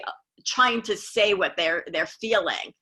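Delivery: ordinary talk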